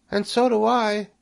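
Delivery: very high, piping voice; tiny creature chiming in last; Nellie the little spider. A narrator's reading. Third spider joins the pledge, high voice. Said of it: "said Nellie".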